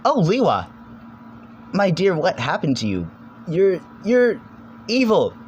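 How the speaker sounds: mock dramatic shock. Dramatic reaction to Liwa.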